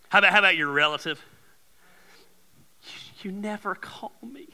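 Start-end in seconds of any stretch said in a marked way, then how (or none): 2.8-4.5 hushed, whining whisper; whispered complaint. Acted. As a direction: breathe it like a sulky relative. Voicing complaining relative.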